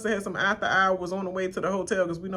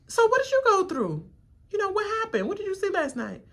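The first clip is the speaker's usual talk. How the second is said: mock questioner; 12 semitones higher than usual. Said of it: "they ask you".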